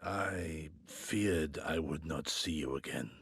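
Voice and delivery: hushed, slow confession; soft and slow. Acted. To an old friend, soft and slow with quiet relief. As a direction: let the relief show, quietly.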